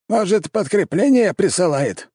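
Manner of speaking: high-pitched, puzzled question; surprised. A narrator's reading. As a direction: ask it high, in open surprise. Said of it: "удивлённо проговорил император". (удивлённо)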